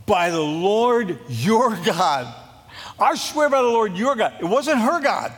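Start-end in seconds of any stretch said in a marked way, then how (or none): 3.0-4.3 higher and louder, stress on "swear"; emphatic oath. Retold or imitated. Widow insisting to Elijah, higher and louder on "swear". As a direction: hit "swear" hard and lift the whole line. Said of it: "And she said".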